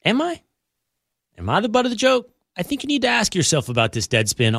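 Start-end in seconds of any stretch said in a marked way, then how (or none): 0.0-2.3 high, incredulous questions with pauses; mock disbelief. Host questions the article calling him the butt, mock disbelief. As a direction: pitch up in feigned innocence.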